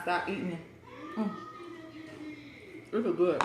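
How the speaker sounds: talking with a mouth full of food. Real, not acted. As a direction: say it while chewing a mouthful. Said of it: "talking about my mouth full".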